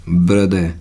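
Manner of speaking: "ब्रदर" said as "bruh-duh", no r; correct pronunciation. Shown as a demonstration. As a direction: drop the final r in "ब्रदर". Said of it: "नहीं बोला जाता".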